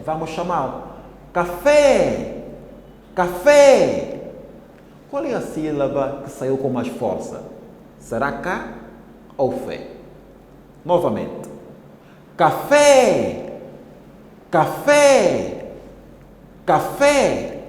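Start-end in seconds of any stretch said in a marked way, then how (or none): 1.3-2.4 higher, louder call to someone far away. Shown as a demonstration. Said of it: "Devemos chamá-las com uma voz mais forte". 3.2-4.3 much louder, higher call across a distance. Shown as a demonstration. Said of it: "Devemos chamá-las com uma voz mais forte". 12.4-17.6 higher, louder repeated call to someone far away. Shown as a demonstration. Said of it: "Devemos chamá-las com uma voz mais forte".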